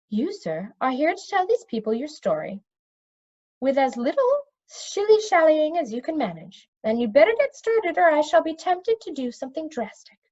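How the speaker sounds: stern witch. A narrator's reading. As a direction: scold him like a stern witch. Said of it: "peered sternly".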